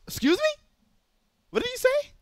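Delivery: way up high, incredulous; imitating a friend's high-pitched outrage; shocked disbelief. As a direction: squeak it out like you can't believe your ears. Friend reacts in disbelief to news.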